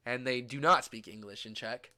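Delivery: ordinary talk, nothing marked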